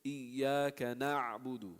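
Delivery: Iyyaka na'budu drawn out slowly, ayn sounding through; slow recitation with a continuous ayn; slow flowing recitation. Shown as a demonstration. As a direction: let the ayn in na'budu flow without a break. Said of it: "you hear it".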